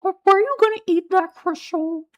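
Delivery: very high squeaky cartoon impression; Carl Wheezer. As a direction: pitch it way up, squeaky and stammered at the start. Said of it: "it's kinda squeaky".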